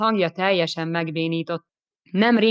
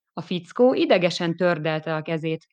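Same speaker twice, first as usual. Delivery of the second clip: ordinary delivery, nothing marked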